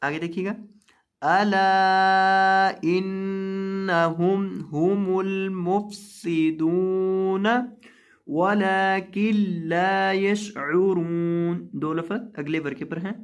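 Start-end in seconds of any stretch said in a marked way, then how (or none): 1.2-11.7 slow, louder chanted verse; Quran recitation. Acted. Teacher recites the verse aloud, slow chant. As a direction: intone each word long and steady.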